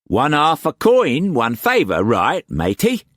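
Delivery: high, loud Cockney pirate-trader voice; Cockney swagger, pitched well up and pushed; harsh Cockney accent. A narrator's reading. Wu Kwok pressing food on Struan, in harsh Cockney accent. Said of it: "the harsh Cockney accent".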